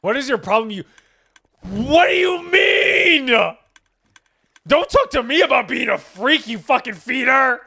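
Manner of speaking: high, loud, hammed-up yelling; over-the-top indignant shout; mock outrage. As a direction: scream at your friend in playful fury.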